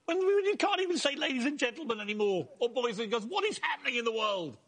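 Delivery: real indignant rant, voice jumping up high; British outburst of rage. Real, not acted. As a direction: erupt in shrill disbelief at the world. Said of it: "it was a British outburst of rage".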